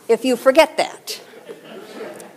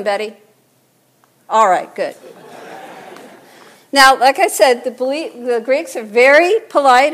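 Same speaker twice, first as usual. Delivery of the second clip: usual speaking voice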